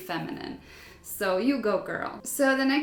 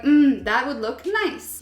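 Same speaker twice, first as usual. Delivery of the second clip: slow, louder, higher musing mm; a slow considering hum, then approval; 4 semitones higher, 6 dB louder than usual. Retold or imitated. To the tattoo artist, a slow approving hum. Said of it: "And I was like".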